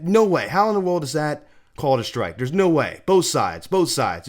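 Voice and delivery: loud, high imitation of an indignant batter; acted protest. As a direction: argue the call loudly as the batter. Acts out batter arguing with umpire.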